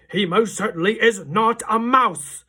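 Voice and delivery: slow, loud, high furious denial; indignant shout. A narrator's reading. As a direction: pound every word in rage. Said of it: "shouted Mister Jenkins".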